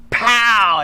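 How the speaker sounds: long drawn-out "pow"; slow-motion mock hit; imitated smack. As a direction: stretch out the impact noise.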